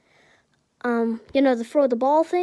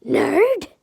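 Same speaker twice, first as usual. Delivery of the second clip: loud, high-pitched yelled insult; Bakugo yelling; 8 semitones higher, 8 dB louder than usual. Acted. Hot-headed classmate yells out at Izuku, loud yell. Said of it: "Bakugo yells out".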